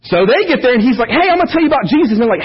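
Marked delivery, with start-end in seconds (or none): 1.1-2.2 high, fast, cheery greeting; bursting with naive enthusiasm; missionary to the tribe, chirpy and rushed; eager missionary. Retold or imitated.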